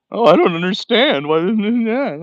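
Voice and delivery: high, loud mock bewildered whine; loud high whine of mock confusion; mock clueless people. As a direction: ham up a confused builder. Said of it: "and then they think".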